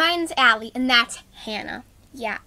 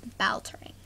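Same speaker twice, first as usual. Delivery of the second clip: whisper; 2 semitones lower, 8 dB quieter than usual. Acted. Whispered aside.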